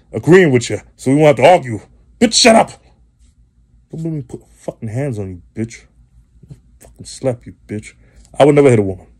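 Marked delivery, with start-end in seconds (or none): 2.2-2.7 high-pitched snap. Acted. Acted quote, much higher. 3.9-7.9 quiet threat. Acted. Acted threat, much softer.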